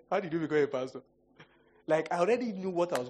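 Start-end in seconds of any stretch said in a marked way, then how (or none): 0.0-1.0 chuckling mock rebuke; scolding his own evil thoughts, laughing; laughing self-scold. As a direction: tease yourself through laughter. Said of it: "sometimes I like".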